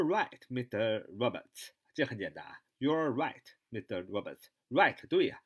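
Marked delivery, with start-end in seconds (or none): none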